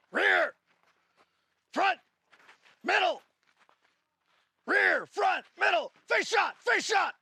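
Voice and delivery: loud high commands to the class; shouted drill calls. Real, not acted. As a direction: call each position out hard. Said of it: "calling drill positions".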